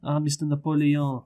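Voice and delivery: hammed-up French accent, halting delivery; mock French accent. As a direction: ham it up as a Frenchman addressing his leader. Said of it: "some, some French accents".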